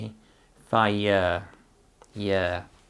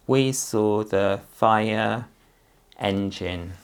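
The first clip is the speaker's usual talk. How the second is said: slow read-back; much slower than usual. Shown as a demonstration. Teacher checking the sentence with the child, slow modelling. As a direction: say each word slowly and deliberately.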